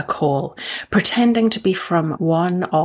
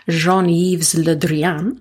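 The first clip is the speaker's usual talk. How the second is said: slow, careful French accent; 2 semitones lower, much slower than usual. Shown as a demonstration. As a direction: say the name like a native French speaker. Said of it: "that's attempting my French accent there".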